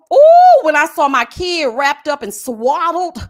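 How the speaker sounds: loud, slowed mock mourning; mock wailing grief. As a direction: ham up his crocodile tears. Mocking his fake grief.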